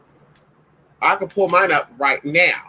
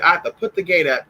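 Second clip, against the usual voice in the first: ordinary delivery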